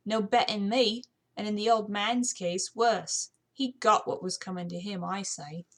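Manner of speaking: put-on defiant servant girl; cheeky British housemaid. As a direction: talk back about the master.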